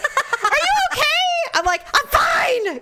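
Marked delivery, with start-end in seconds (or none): 0.0-1.5 high, drawn-out imitation of a worried partner; concerned partner calling out. Partner calling out after hearing her fall, high and worried. 1.9-2.8 high, irritated retold shout; mad yell. Hurt woman yelling back at her partner, mad. 'cause now I'm mad.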